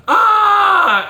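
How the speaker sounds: a huge high shout into the canyon; scream. Shown as a demonstration. Showing a scream at the Grand Canyon edge, loud high shout. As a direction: yell it out over the edge. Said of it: "a really loud".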